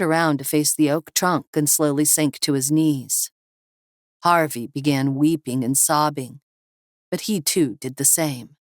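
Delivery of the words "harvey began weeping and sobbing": plain reading voice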